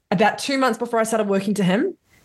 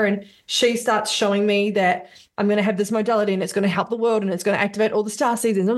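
normal talking voice

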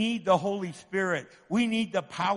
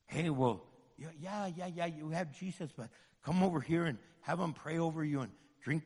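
soft, sly, dismissive chatter; mocking tempter; 4 semitones lower, 7 dB quieter than usual. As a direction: lure them away quietly. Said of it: "we do all kinds of weird stuff".

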